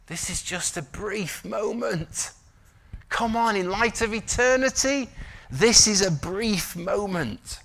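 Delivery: high-pitched, slow, urgent preaching; impassioned exhortation. Real, not acted. Preacher urging the congregation in light of eternity, impassioned exhortation.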